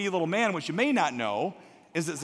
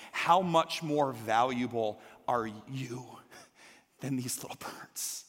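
breathy hush at the end; whisper; 5 semitones lower, 4 dB quieter, slower than usual. Acted. Jesus assuring listeners they matter, whispered. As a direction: let it fall to a whisper. Jesus reassuring, voiced intimately.

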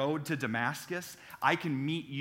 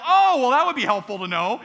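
sarcastic reply; 8 semitones higher, 11 dB louder than usual. Retold or imitated. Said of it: "And I go".

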